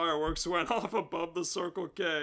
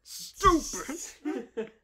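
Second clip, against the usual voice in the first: self-scolding; 7 semitones higher, much slower than usual. Acted. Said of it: "Why did I say spank or kill?".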